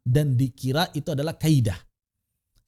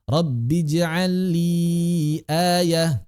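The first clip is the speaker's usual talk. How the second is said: slow chanted verse; recitation; much slower than usual. Shown as a demonstration. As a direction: chant it slowly. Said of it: "recited verse demo". (tilawah pelan)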